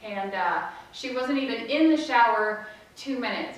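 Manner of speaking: ordinary delivery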